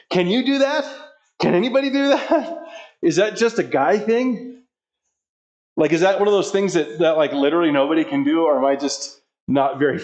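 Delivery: ordinary delivery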